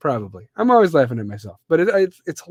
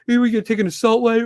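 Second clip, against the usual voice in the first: normal talking voice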